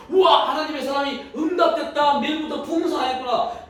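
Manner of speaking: 응답받은 듯 높게 들뜬 감탄 흉내; acted elation. Acts widow thrilled at answered prayer. (높고 들뜬 기쁨 연기)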